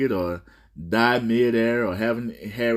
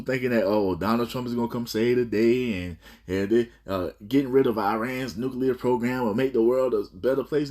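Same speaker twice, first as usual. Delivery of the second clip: ordinary talk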